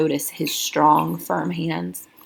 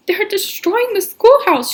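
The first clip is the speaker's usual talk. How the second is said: much higher, louder, out of breath from running; breathless panic; 6 dB louder than usual. Acted. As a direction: gasp it out to the sheriff. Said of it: "gasping for breath".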